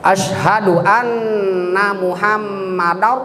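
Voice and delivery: slow chant, "Muhammadar" ending rounded like "-dau"; correct thick R. Shown as a demonstration. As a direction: end "Muhammadar" heavy, without a trill. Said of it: "Gitu bacanya". ("Muhammadar" dilantunkan dengan ro tebal)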